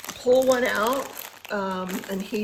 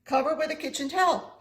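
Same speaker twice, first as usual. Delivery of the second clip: ordinary talk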